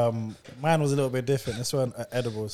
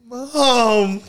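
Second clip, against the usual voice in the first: drawn-out, higher mock call; trying to shout; 5 semitones higher, 4 dB louder than usual. Son trying to shout for mum like in dreams, strained-for mock call. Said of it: "you're trying to shout".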